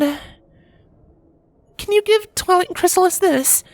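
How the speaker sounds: high mare voice, small and apologetic; a princess pony asking a favour; Cadance voice. A narrator's reading. Cadance asking her husband to apologize for her, high voice.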